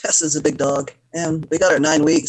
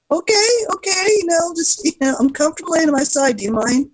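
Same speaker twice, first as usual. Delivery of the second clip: high-pitched, put-upon pleading; mock exasperation; 11 semitones higher than usual. Owner lying on side answers cat, mock exasperated. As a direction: protest to a pet in a raised pitch.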